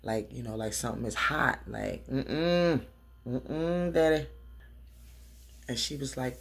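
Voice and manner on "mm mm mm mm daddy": louder mm-mm mm-mm then daddy, higher; mock no-way hum with attitude; sassy refusal. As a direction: shake your head and refuse. Refusal pulling hand away.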